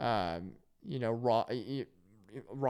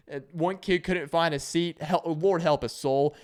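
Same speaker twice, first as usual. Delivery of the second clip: ordinary delivery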